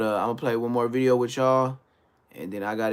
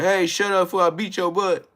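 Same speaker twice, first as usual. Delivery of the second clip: higher, louder yelled threat; raised-voice mock scolding; background yelling; 7 semitones higher, 5 dB louder than usual. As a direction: imitate yourself yelling at someone off mic. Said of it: "yelling in the background".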